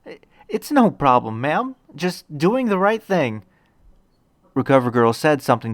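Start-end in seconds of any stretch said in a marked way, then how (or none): 0.1-3.4 higher, holding back tears lightly; a hint of a boy fighting tears; bashful, moved young hero; choked-up boy hero. A narrator's reading. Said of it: "trying hard not to cry".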